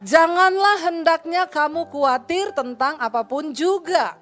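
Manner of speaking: fervent pulpit preaching. Acted. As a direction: drive the verse home to the congregation. Preaching the verse on worry.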